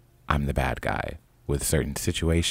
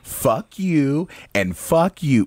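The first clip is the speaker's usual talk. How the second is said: slow, higher, every word spat out; slow retold curse; 10 semitones higher, 4 dB louder, much slower than usual. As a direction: drag the curse out for effect. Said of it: "And I'ma say it again".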